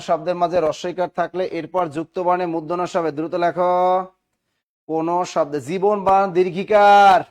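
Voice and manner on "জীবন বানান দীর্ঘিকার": loud, raised classroom call. Real, not acted. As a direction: call the spelling out loudly to the class. Calling out a spelling to class. (জোরে হেঁকে বলা)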